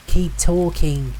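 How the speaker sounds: high, drawn-out call. A narrator's reading. Navigator to lost stranger, calling out. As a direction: pitch it up to carry through fog. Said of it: "Katie called out".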